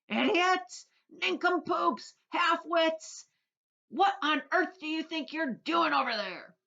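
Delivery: very high, loud insulting yell. Acted. Centipede yells insults at the cloud men. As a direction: bellow insults across the sky. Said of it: "yelling at the cloud men".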